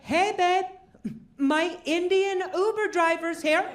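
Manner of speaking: high-pitched call to another room. Acted. Husband calls to his wife in the next room, calling out. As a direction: call out cheerily, pitched well up. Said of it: "I called to my wife in the next room".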